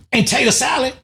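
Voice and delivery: high, soft mock woman; meek woman. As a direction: add it in a small high voice. Retold Cops arrest argument.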